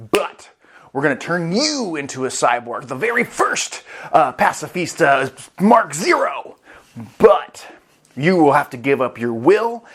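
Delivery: loud, emphatic pitch with swooping highs; hammed-up deal-maker. Saturn offering to save the daughter, grandly emphatic. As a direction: announce each condition grandly, punching key words.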